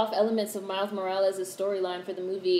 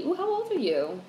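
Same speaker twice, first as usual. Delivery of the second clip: surprised ooh; 9 semitones higher, 3 dB quieter than usual. Real, not acted. Reacting to a chat comment.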